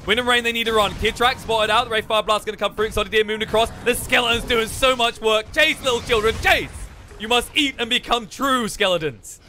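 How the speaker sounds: high, rapid esports commentary; live play-by-play. Real, not acted. Caster calling a team fight live, excited play-by-play. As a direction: call the fight with rising urgency.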